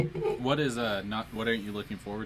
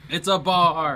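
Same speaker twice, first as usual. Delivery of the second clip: louder, higher exclaimed repeat; loud exclamation; 7 semitones higher, 10 dB louder, much slower than usual. Real, not acted. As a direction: call it out again.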